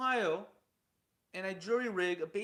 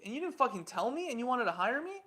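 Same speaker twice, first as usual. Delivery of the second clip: higher, rising disgust; indignant outrage; 6 semitones higher than usual. Retold or imitated. Telling off the rival who hid the farm purchase, higher and indignant. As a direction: let the betrayal boil over.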